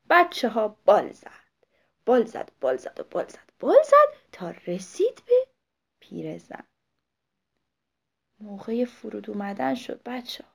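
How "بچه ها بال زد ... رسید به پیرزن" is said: lively story narration. A narrator's reading.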